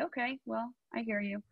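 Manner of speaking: soft, breathy brush-off; calm dismissive. Retold or imitated. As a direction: let it slide off you. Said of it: "now I can just say".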